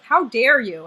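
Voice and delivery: loud, mock-offended how dare you; mock indignant. Dee's indignation acted out loud. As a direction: play the outraged diva. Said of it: "she's like".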